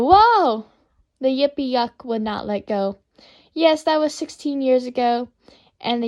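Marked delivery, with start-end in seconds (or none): 0.1-0.6 yelled whoa. Retold or imitated.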